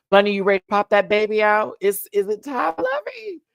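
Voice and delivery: high, fast sing-song teasing. Real, not acted. Teasing Bunny about the baby, high and sing-song. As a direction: coo and tease playfully.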